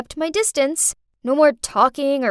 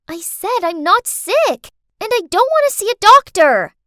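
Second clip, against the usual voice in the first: put-on child's angry protest; 8 semitones higher, 8 dB louder than usual. Girl fed up with being asked if she's sick, angry protest. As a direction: build to an exasperated kid's yell.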